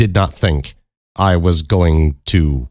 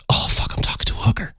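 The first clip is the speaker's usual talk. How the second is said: quick, hushed panicked aside; inner-thought whisper; 9 semitones higher, 8 dB quieter, faster than usual. Retold or imitated. Teller voices his alarmed thought about her, whispering. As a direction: whisper it fast, like a private thought. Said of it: "in my mind, I'm going".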